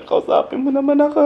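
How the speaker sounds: high, whiny plea to the dog; whiny pleading. Pretend. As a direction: beg the sleepy dog to talk to you.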